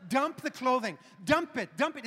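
loud, insistent retold orders; urgent command. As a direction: order it out in a mock emergency. Frantic orders to discard soiled clothes.

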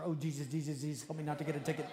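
frantic gabbled prayer, mock desperate; rapid-fire praying. Driver pulled over by police, praying real fast. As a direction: rattle the plea off without a gap. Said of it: "Have you ever prayed something real fast?".

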